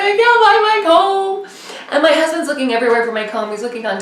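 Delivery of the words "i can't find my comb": high, loud sing-song sobbing; mock crying wail. Wife sobbing on the couch over the comb, mock wail. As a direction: whine it out like a tearful child. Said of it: "I was just crying".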